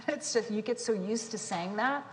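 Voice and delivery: usual speaking voice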